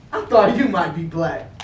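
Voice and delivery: ordinary delivery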